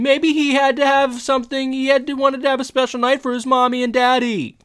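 put-on high, loud yell after the girls; yelling after them. Eric yelling after the girls who mock the rave organizer, loud and high. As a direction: shout it after them, rattled. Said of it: "he yells after them".

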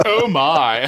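a lightly voiced George Takei catchphrase; imitating George Takei. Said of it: "And then he went".

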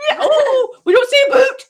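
high, loud playful objection; exaggerated high-pitched Canadian protest; mock protest. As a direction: shout it in comic indignation. Exaggerated protest about Canadian accent.

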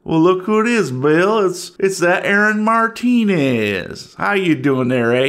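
sneering small-town Southern voice; Southern yokel. Acted. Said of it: "I don't know why I'm from the South".

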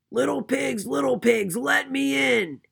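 loud, high-pitched wolf demand; hungry big bad wolf bellow; growling wolf. A narrator's reading. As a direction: growl it loudly, stretching the last words. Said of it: "he growled".

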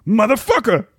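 high, tough movie-gangster voice; Samuel L. Jackson impression. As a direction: growl it like a badass gangster. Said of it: "speed round".

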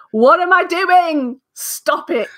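high, slow exasperated what-am-I-doing; self scolding. Retold or imitated. Guest on the sofa scolding herself, high exasperated. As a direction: scold yourself, drawn out and high.

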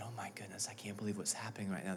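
breathless whispered disbelief; awed whisper. Acted. Disciples thinking in the boat, awed whisper. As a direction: whisper it in awe. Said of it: "disciples are thinking".

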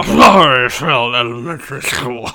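put-on a growly British bulldog accent; Churchill impression. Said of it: "do it again in Churchill".

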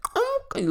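loud, high held honk; full car honk. Retold or imitated. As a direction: blare it out like a long horn. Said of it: "not a real ... a nice little honk".

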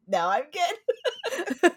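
very high, drawn-out squeak through laughter; imitated polite refusal. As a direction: squeak it out slowly, half laughing. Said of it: "and I'd be like".

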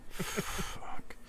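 ordinary talk, nothing marked

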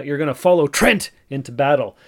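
you're gonna follow Trent into battle, with Trent punched loud; rally the troops around Trent; commanding name. Shown as a demonstration. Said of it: "sharp stopping syllable".

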